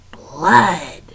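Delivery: hungry rats in unison; menacing crowd reply; rat chorus. Acted. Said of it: "they all agreed together".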